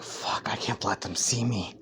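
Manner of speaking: low hushed whisper; panicked hiding whisper. Acted. Deku hiding under the covers, whispering to himself. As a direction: whisper it so nobody hears.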